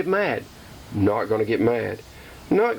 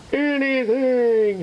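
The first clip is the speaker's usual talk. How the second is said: drawn-out high anything; 11 semitones higher, much slower than usual. Real, not acted. Vowing not to break anything, stretching "Anything" out high. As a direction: draw "Anything" out high and slow for emphasis. Repeats the word, second stretched.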